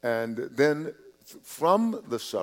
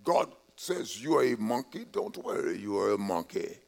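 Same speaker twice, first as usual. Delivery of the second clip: lightly imitated thick Nigerian accent, softer voice; strong Nigerian accent; 2 semitones lower, 7 dB quieter than usual. Pastor quotes an admired bishop's saying to the congregation, thick Nigerian accent. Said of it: "in his strong, thick accent".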